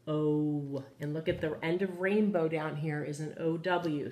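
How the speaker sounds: ordinary delivery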